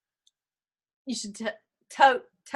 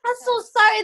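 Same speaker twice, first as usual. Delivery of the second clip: mock apology; 5 semitones higher, 10 dB louder than usual. Apologizing loudly, mock blinded.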